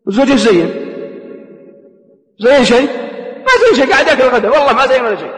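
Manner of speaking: mock indignant wife. Wife's mocking complaint about husband.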